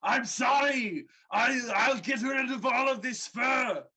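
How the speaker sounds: loud, high frantic apology; panicked dryad yelling; terrified screaming. Acted. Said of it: "she screams in terror".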